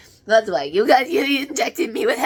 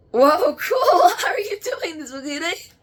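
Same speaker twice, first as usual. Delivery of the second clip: high, loud, laughing shout of amazement; delighted shout; 7 semitones higher, 6 dB louder than usual. Real, not acted. Medium amazed at the spirit's trick, shouting with laughter.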